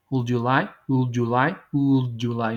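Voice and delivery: "Would" opened on a drawn-out oo, no G; long U, no G. Shown as a demonstration. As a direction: model "Would" slowly as "uuld", running into "you". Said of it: "No hay una G".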